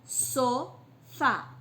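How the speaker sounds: "Sofá" split into SO... FÁ; each syllable of "Sofá" said as its own beat. Shown as a demonstration. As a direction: pause between so and fá in "Sofá". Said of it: "Cada vez que eu abro minha boca".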